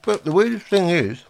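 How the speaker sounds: talking through a mouthful of crisps; words round a bite of food; mouth full. Real, not acted. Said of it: "talking with food in my mouth".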